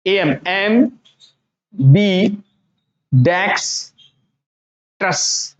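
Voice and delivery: "एम, बी, डेक्स, ट्रस" piece by piece; each part held apart for learners; split into parts. Shown as a demonstration. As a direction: break ambidextrous into syllables with long gaps. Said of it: "कुछ parts में divide कर दिया".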